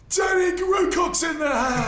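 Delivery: very high, loud, stretched-out heckle; heckling shout. Acted. Drunk mate interrupts a comedy act, heckling shout. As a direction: bellow it across a crowded club. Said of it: "one of our mates just shouts".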